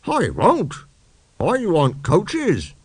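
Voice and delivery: high, slow stubborn pout; sulky refusal. Acted. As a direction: dig in like a spoilt child. Engine refuses.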